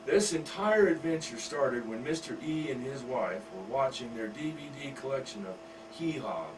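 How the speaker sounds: ordinary delivery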